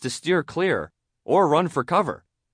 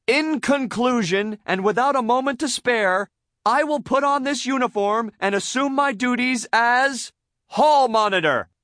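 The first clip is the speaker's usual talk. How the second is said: high, loud, ceremonious wrap-up; grand SpongeBob conclusion; 12 semitones higher, 5 dB louder than usual. A narrator's reading. SpongeBob wrapping up long speech, ceremoniously. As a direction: proclaim it with building pomp. Character ending a pompous speech.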